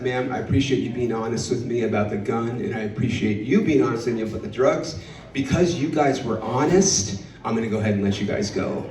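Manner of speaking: low, measured official delivery; measured officer. Acted. Officer letting the honest couple go, lightly voiced low and measured.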